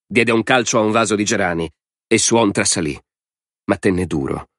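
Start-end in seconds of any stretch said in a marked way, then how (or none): none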